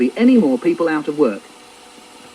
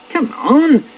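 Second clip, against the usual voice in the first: raised, high-pitched impatient call; a bit cross; 12 semitones higher, 3 dB louder than usual. Acted. Dad at the locked door, getting cross. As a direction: push it up, losing patience. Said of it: "getting a bit cross".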